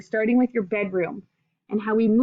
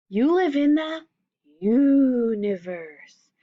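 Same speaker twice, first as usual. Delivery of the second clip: universe stretched out very slowly; pause, then draw out universe with awe; slow, awed universe; grand reveal; much slower than usual. Acted. Climactic reveal in read-aloud.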